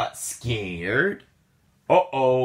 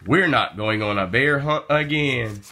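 ordinary delivery, nothing marked

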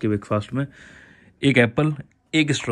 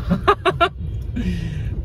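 real burst of higher laughter; amused laugh, higher than speech; 6 semitones higher than usual. Real, not acted. Laughs at forgetting the dessert.

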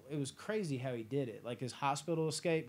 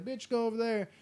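mock dismissive Seagal; 8 semitones higher than usual. Seagal ducking Richie's punch, mock sneering brush-off. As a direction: toss him aside with a sneer. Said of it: "He's like".

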